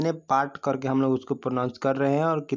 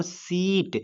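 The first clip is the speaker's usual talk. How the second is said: सीट with a long, raised ई; stretched demonstration word; 5 semitones higher, much slower than usual. Shown as a demonstration. Teacher showing students the stressed vowel, सीट stretched high. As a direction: say सीट drawn out and higher on the vowel. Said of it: "क्या मैं बोल रहा हूँ".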